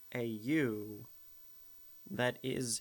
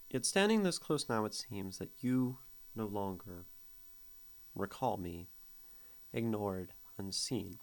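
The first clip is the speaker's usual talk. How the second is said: dramatic recital of a poem; poem reading. Acted. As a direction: read the verse with weight and space. Reads poem aloud.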